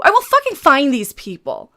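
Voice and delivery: ordinary talk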